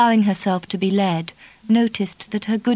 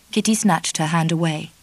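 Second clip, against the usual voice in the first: ordinary delivery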